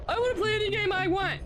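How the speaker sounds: mock demanding. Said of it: "and I said".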